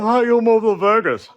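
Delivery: high, loud mocking question; mocking people asking. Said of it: "People are like".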